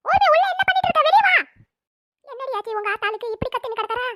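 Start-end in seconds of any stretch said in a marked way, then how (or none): 0.0-1.4 high, loud furious yell for the daughter; angry yell. Acted. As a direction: scream her name to drag her out. Mother calling daughter from outside.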